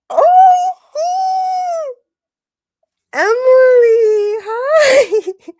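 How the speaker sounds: very high, loud, slow squeal; crying out with joy; overjoyed cry. Real, not acted. Streamer greets the donor, shrieking with joy.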